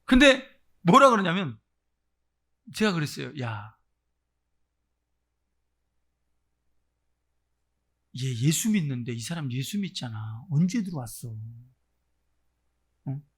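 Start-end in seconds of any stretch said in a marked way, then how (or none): 3.4-13.3 much lower confronting voice; re-enacted low-pitched questioning; low interrogation. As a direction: question an intruder in a low, controlled voice. Acts own questioning of the spirit.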